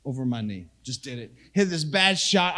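usual speaking voice

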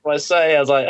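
ordinary delivery

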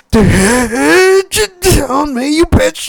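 high-pitched, hammed-up sobbing; mock crying. Gamer crying on the couch at Aunt May's death, exaggerated sobbing. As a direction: imitate yourself blubbering helplessly through the words. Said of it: "I'm on the couch just like".

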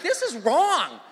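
high, loud retold panic; mock-panicked outburst; freaking out. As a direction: act out alarmed protest, voice jumping up. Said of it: "So I was freaking out".

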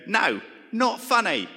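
loud, high, slow dismissive shout. Retold or imitated. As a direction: shoot the idea down like a pampered rock star. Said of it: "he'd go".

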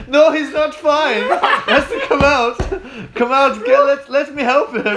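hammed-up pushy woman banging on a toilet door; frantic German lady. Retelling a woman demanding Jack come out of the toilet, loud high mock. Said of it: "this woman is like".

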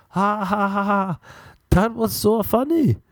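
high, hammed-up foreign accent; botched Spanish accent, stretched and high; failed Spanish accent. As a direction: badly imitate a laughing Spanish friend. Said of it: "that's not a Spanish accent".

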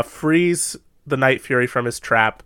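ordinary delivery

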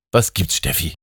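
usual speaking voice

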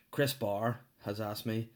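ordinary delivery

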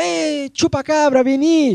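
retold friends' yell. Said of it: "ellos gritaban".

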